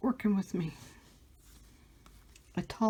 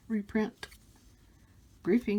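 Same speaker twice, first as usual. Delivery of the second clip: ordinary talk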